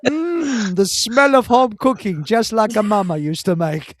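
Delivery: hammed-up relish of a fine smell; dreamy mock appreciation of a home-cooked meal; mock savouring. Mock praise of the stinking loo.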